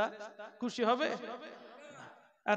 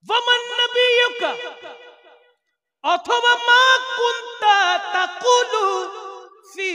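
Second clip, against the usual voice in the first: much higher, louder, slow booming chant; angel's thundering question; 11 semitones higher, 11 dB louder, slower than usual. Acted. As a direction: intone the angel's question with full force. Angel's third question intoned. (ফেরেশতার গম্ভীর উচ্চ আহ্বান)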